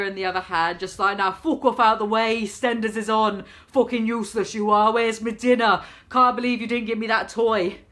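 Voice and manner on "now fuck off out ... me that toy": put-on loud bossy wide-boy barking; a cocky geezer ordering mum about; little Del Boy. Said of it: "this baby being a little Del Boy".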